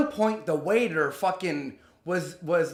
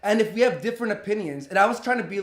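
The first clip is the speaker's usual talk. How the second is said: ordinary talk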